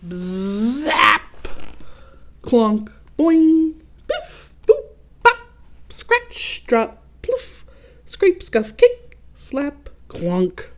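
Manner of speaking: punchy cartoon sound words, some shouted high; sound effects. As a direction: bang out each comic noise word. Sound effects read aloud.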